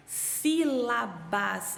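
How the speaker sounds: "Sílabas" very slowly in separate beats; slow, measured syllables. Shown as a demonstration. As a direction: break "Sílabas" into sí-la-bas. Teacher models splitting into syllables.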